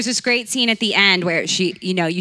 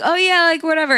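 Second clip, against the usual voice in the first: higher-pitched breezy brush-off; dismissive; 10 semitones higher, faster than usual. Retold or imitated. Comic recalls shrugging off the remark, dismissive. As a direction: act out a quick, higher "whatever". Acts out own dismissive reply.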